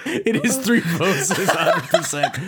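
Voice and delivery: high, slow words through laughter; laughing. Real, not acted. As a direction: laugh while you say it. Laughing at three-vote poll.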